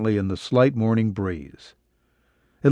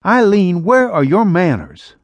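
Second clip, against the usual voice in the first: higher, louder mother's scolding voice; mother to daughter, louder scolding call; 6 semitones higher, 3 dB louder than usual. Acted. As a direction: call her daughter to order, raised up.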